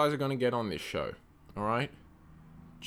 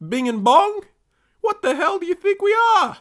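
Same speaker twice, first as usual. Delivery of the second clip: rising, louder and louder and much higher mock yell; hammed-up indignant shouting at the guy; retold mock outrage; 12 semitones higher, 10 dB louder than usual. Telling off the guy at the party who suggested a doubles comedy act, a hammed-up shout that keeps climbing. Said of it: "I says, it says to the guy".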